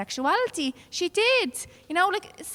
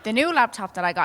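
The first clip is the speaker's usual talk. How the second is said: ordinary talk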